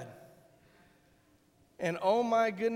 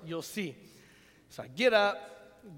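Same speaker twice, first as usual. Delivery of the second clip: ordinary talk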